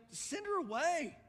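high, exasperated disciples' complaint; urging. Retold or imitated. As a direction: act out fed-up followers, loud then easing off. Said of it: "they urged him".

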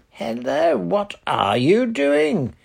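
high, loud hail across the water; bright hello shouted from a dinghy; loud cheerful call. A narrator's reading. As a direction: call out cheerfully to the boat party. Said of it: "came a loud, cheerful voice over the water".

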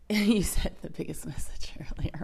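unvoiced whisper aside. Real, not acted. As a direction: whisper it half laughing. Joking aside to co-host.

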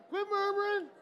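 high, loud, punchy command; loud rebuke. Shown as a demonstration. Preacher has congregation tell neighbours, loud scolding call.

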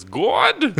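"God" said high and loud; Jordan Peterson. Retold or imitated. Mimicking how Jordan Peterson says "God", high and loud. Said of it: "I like the way he says God".

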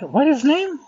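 high, excited asking; eager question. Retold or imitated. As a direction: lean in, curious and thrilled. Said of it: "I'm like".

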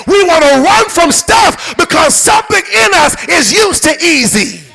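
high, driving sermon shout; full-force preaching. Real, not acted. Preacher exhorting church to endure hardness, full-force preaching. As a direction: drive each phrase home to the congregation, pitched high.

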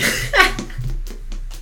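high, wheezy burst of laughing; burst of laughter. Real, not acted. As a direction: crack up at a bad pun. Laughing at molasses pun.

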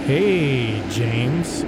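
slow, drawn-out creepy greeting. Retold or imitated. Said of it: "in this really creepy tone of voice".

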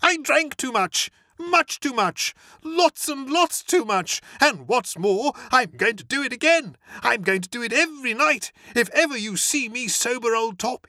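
high, loud and gleeful boasting; Motty boasts of drinking to Bertie, high and loud; exultant young toff. Acted. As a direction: crow about the drinking like a proud schoolboy.

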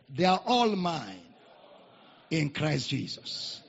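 usual speaking voice

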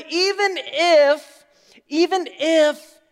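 high, loud, drawn-out words; slow, ringing acted declaration; emphatic preaching. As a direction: proclaim it slowly at full voice. Preacher voices Jesus emphatically.

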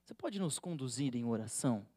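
imitating a colleague's solemn invitation to pray; mock seriousness. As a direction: ask with exaggerated gravity. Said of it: "Com essa seriedade assim".

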